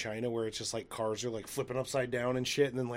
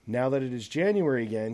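ordinary delivery

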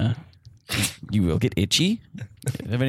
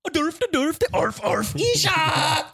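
high, hammed-up gibberish German; mock authentic German; 13 semitones higher than usual. Exchange student translating for the class, mock German accent. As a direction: bark out fake German nonsense words. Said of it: "You're gonna hear an authentic German".

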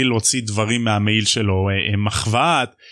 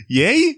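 high rising puzzled eee; high puzzled squeal; 11 semitones higher, slower than usual. Retold or imitated. Harry reacting to the owl, a high puzzled squeal. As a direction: squeal it like a confused kid.

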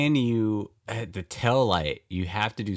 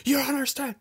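high, yelled mock outburst at callers; mock yelling; faster than usual. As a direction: yell it back at them, half joking. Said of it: "I would literally be yelling".

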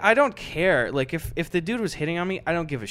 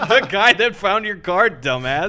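snotty, a bit louder put-on voice; rude retort; 4 semitones higher, 5 dB louder than usual. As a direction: throw it back at him with contempt. Caller answering rudely, quoted.

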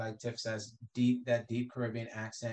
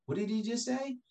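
high-pitched incredulous onlooker; retold astonished question; mock disbelief; 11 semitones higher than usual. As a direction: imitate a baffled kid, pitch jumping up. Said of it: "they'd be like".

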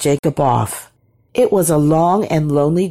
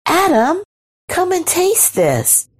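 very high, calling invitation; high, eager female character; woman's voice; 13 semitones higher than usual. A narrator's reading. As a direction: beckon in a high sing-out. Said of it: "she said".

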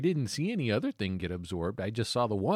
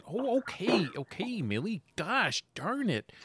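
high, soft, exasperated grumble; Harold; 6 semitones higher, 5 dB quieter, slower than usual. Acted. Harold grumbling to Millie outside, high and exasperated. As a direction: raise the pitch and grumble softly, as if overheard. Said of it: "you hear outside, um, Harold saying".

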